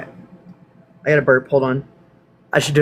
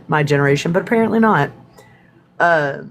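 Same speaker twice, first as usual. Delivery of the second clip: normal talking voice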